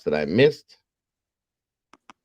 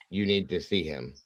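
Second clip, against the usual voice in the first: low-key, softer retold warning; mild grave warning; 3 semitones lower, 6 dB quieter than usual. Mother warns him about their son, grave warning. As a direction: retell it quietly, after a weighty pause.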